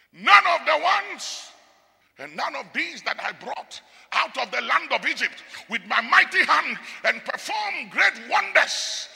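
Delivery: high, loud solemn oath; preacher voicing God's judgment; God swearing judgment. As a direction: declare it like God passing sentence.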